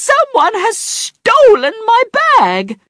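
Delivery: very high, slow shriek; screaming. A narrator's reading. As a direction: shriek the theft. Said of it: "she screamed".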